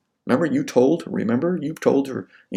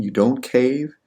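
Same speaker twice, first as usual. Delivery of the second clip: ordinary talk, nothing marked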